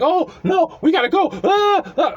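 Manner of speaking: high imitation of a frightened girl; hammed-up high-pitched alarm; mock screaming panic. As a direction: fuss in mock panic, breaking into little shrieks. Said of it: "she was screaming".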